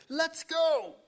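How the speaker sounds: high, loud eager shout. Retold or imitated. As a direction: shout it out to the pilot. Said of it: "then he shouted to the, to the pilot".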